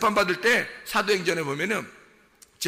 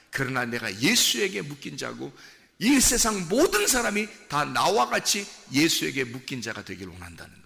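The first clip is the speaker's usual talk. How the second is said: ordinary delivery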